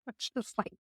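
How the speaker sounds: ordinary talk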